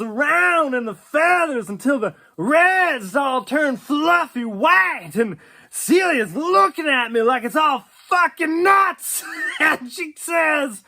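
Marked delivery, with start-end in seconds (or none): none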